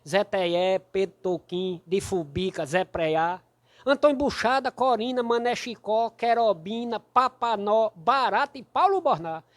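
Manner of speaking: loud, rhythmic roll call of names; cordel verse recitation. Acted. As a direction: declaim the rhymes with a hammered beat. Recites rhymed verse about his hometown. (declamação de cordel, forte e ritmada)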